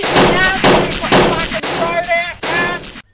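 high, far-off furious threat; menacing yell. Acted. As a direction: roar it like a movie villain. Villain threatens Norton.

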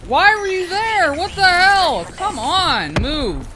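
high, loud outburst at another player; exasperated yell. Real, not acted. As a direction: shout it in disbelief and frustration. Yells at player in his way.

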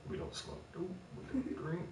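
mocking whisper. Mocking the group's refusal.

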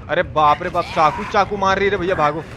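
ordinary talk, nothing marked

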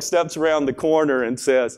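ordinary delivery

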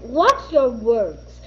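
slow, low stern warning; a hint of a stern mother warning; stern mom. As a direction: warn her slowly. Mom scolding child.

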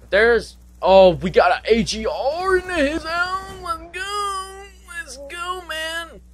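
high, thrilled shout of excitement; excited yelling. Real, not acted. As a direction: hype it up, voice way up. Hyped gamer calling teammates.

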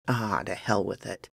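low, soft grumble; muttered curse. Acted. Gunman giving in to the steep price, muttering. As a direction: give in with a quiet mutter. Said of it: "cursed under his breath".

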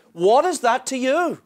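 what is that to you, flung out high; rising challenge. Acted. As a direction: challenge them hard on is. Jesus voiced in sermon.

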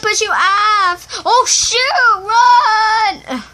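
high, loud yell of alarm; panicked shout. Real, not acted. Boy warning friend about being pushed off, high panicked shouting. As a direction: scream a frantic warning.

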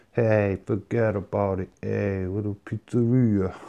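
naff put-on New York accent. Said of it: "That is my naff New York accent".

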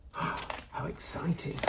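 hushed, slow, breathless wonder; breathy whisper. A narrator's reading. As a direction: whisper it in awe.

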